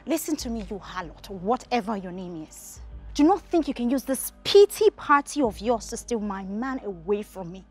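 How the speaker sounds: loud, emphatic warning to a rival; angry confrontation. Acted. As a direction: lay down the law to a rival. Confronting a rival over Richard.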